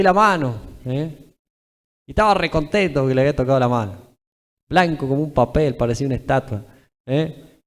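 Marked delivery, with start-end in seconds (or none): none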